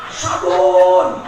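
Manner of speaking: loud low barked demand; demanding shout. Acted. Husband barks at wife for soap, loud demanding shout. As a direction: bark the order from the bathroom.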